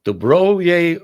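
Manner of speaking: loud, hammed-up Russian accent, drawn out; mock Russian. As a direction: show off bad Russian at full volume. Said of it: "That's my Russian".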